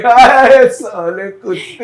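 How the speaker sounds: high bright hi then a teasing insult; mocking greeting. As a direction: sneer it with a fake friendly hi. Guys across river mock-greet.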